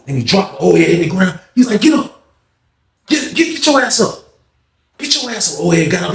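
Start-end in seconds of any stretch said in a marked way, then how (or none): 1.8-5.6 taunting yell. Retold or imitated. Yelling at beaten man.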